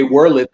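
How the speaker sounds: "whirl it" for "world", two clumsy syllables; mock immigrant "whirl it" for world; mispronounced world. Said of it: "saying America is the best country in the world".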